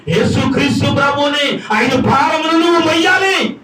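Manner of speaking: high-pitched, driving sermon voice; full-force preaching. Acted. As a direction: preach it out to rouse the congregation.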